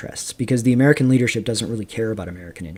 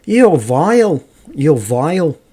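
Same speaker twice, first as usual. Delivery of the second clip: high, loud lower-class Midlands English; mock Midlands accent, stretching vile; 4 semitones higher, 5 dB louder, slower than usual. Explaining how chavs in England say vile, in a mock Midlands accent. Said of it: "a lower class accent in the Midlands of England".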